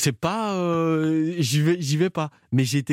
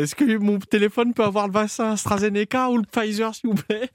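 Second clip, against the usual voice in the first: anxious customer voice; 7 semitones higher than usual. Acted. Acted customer at Apple Store.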